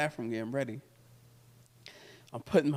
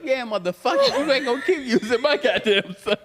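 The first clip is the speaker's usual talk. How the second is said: high, loud furious rant, laughing through it; be the mom cursing him out the second the call ends; mother cussing out; 10 semitones higher, 7 dB louder than usual. Retold or imitated. Mother cursing after hanging up.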